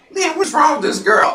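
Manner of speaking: a self-mocking take on [name]; [name] impersonation. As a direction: [name] quote done in a high put-on voice. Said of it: "was my best [name] impersonation".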